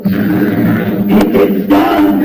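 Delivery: loud booming victory cry; triumphant shout. Shown as a demonstration. Comedian showing his orgasm noise, a loud triumphant shout. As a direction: roar it like a villain's triumph. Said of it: "this is the noise I make when I come".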